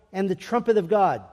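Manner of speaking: ordinary delivery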